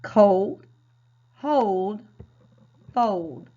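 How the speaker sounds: each word said loudly with a long O; modeled reading. Shown as a demonstration. Teacher models -old words loudly for the class. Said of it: "Let's practice reading some of these words".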